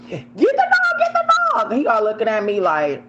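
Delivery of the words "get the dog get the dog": high, loud yell out a car window; yelling frantically at the dog owner; retold yell. Driver yelling to a stranger to grab his dog, high frantic yell. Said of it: "I'm, like, yelling out the window".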